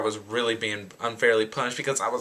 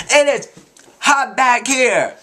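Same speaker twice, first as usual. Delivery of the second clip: high, loud mock holler; loud complaint imitation; 9 semitones higher, 5 dB louder, slower than usual. Girl standing up on the bus, loud mock complaint. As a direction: holler it up high, dragging out the end.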